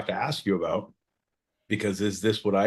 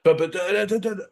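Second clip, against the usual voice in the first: mock stammer; 10 semitones higher, 3 dB louder, faster than usual. Shown as a demonstration. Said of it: "if I'm doing a, a scene".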